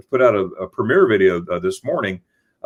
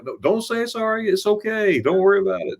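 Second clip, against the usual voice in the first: reassuring; 9 semitones higher, 3 dB quieter than usual. Retold or imitated. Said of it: "I'm like".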